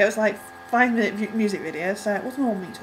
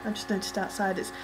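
ordinary talk, nothing marked

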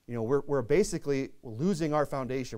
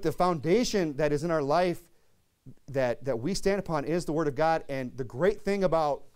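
ordinary delivery

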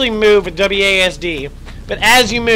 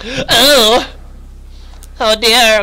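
startled oh dear; 3 semitones higher, much slower than usual. Real, not acted. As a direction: caught off guard by a scare. Gasp at game scare.

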